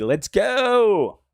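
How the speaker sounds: high, loud rallying cry falling off at the end; excited closing holler; hype shout. Real, not acted. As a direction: fire up the crowd.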